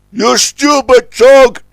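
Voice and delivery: high, loud, slow scolding cry; Scuttlebutt crying out. A narrator's reading. Scuttlebutt is nearly rammed by O J, crying out. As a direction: bawl out an indignant rebuke. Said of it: "cried Scuttlebutt".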